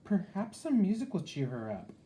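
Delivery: lightly high, hopeful Herbert; hopeful dragon. A narrator's reading. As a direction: wonder it aloud brightly. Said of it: "thought Herbert".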